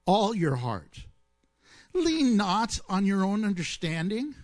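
slow, deliberate scripture read, word by word. Shown as a demonstration. Pastor rereads Proverbs to the congregation, slow and deliberate. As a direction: let each phrase land slowly. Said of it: "but I'm gonna read it much slower".

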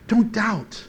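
ordinary delivery

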